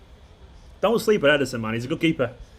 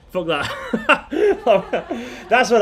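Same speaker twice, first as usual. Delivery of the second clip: laughing; 7 semitones higher, much slower than usual. Real, not acted. Reacting to no haiku entries.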